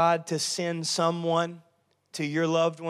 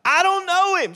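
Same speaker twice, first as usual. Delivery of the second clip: much higher, loud Peter denial; panicked denial; 13 semitones higher, 7 dB louder than usual. Acted. Peter denying Jesus to the girl, high panicked squeak. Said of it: "He's like".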